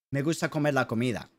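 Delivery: quick flowing Spanish, sounds melting together; fluid Spanish. Shown as a demonstration. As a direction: rattle off Me gusta comer la comida smoothly. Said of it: "Spanish by nature is a very fluid language".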